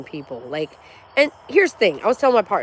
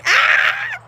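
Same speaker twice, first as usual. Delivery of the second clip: loud, high playful scream; excited yell; 5 dB louder than usual. Real, not acted. Streamer hyping her chat, gleeful yell. Playful yelling during the hype.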